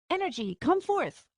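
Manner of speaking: high, hammed-up magic incantation; mock grand command. Arya showing her spell attempt, mock grand command. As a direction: call the spell out like a movie hero.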